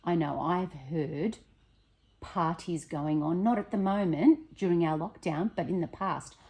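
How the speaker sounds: ordinary delivery, nothing marked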